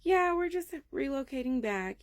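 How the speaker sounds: high, loud breezy reply; put-on politeness. Mother reassures the neighbors, breezy and polite. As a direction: brush it off in a high social voice.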